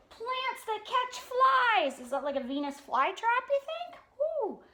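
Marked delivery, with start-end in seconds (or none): none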